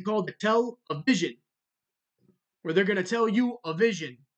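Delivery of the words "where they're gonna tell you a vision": normal talking voice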